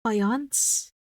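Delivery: faience tried with a French lilt; trying faience the French way; French-style attempt. Shown as a demonstration. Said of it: "It's a French word?".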